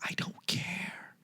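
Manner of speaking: slow whisper, very soft; whispered retort. Real, not acted. Answering the nagging fan with a slow whisper. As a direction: breathe it out dismissively. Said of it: "whispered retort".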